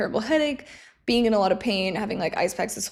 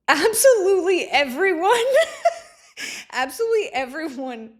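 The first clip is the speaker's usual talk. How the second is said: laughing giddily; 8 semitones higher, 4 dB louder than usual. Real, not acted. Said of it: "laughing about Team everyone".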